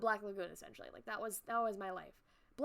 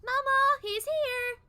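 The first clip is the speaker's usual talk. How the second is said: put-on high, loud little girl calling; excited child call; 13 semitones higher, 13 dB louder, much slower than usual. Sally calling her mother, high and loud. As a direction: shout it happily to mom. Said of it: "Sally mimicking him".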